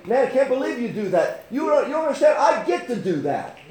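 high, loud testimony from the pulpit; impassioned preaching. Real, not acted. Preaches with raised voice.

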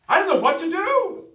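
very high, full-force imitated wail; retold anguished scream. Preacher acts out young woman's breaking point, screaming desperately. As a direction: scream her helplessness at the top of the voice.

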